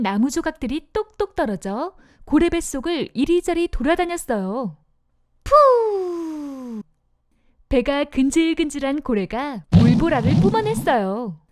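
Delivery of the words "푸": whale spouting whoosh. Acted.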